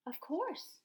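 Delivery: high, confident little answer; cat's proud reply. Acted. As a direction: answer like it's obvious. The cat Lily answers.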